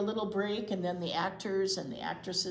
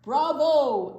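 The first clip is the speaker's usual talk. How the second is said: much louder, higher cheer; echoing bravo; 8 semitones higher, 13 dB louder than usual. Acted. Luigi copying Grandpa's cheer, with a loud shout. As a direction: shout it right after Grandpa. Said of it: "echoes Luigi".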